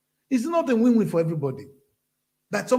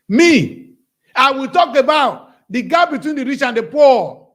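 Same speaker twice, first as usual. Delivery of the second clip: loud, higher, slow and forceful; angry declaration; 7 semitones higher, 11 dB louder, slower than usual. Real, not acted. Telling viewers his own plan, angry and loud. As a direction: pound out each word with anger. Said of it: "when I talk like this, I get angry".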